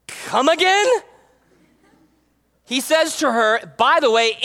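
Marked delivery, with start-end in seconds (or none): 0.1-0.9 high, rising mock challenge; affronted mom. Mom responding to being called woman, affronted. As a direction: imitate a mom daring you to repeat that. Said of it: "my mom would be like".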